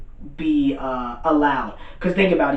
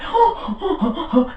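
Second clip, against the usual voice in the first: high, exaggerated gasping; shocked gasps; 12 semitones higher than usual. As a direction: act out a stunned viewer gasping. Acts out viewers' shock.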